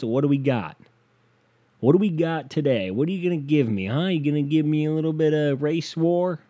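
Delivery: manic demanding. Acted. Acted demanding of the day's news.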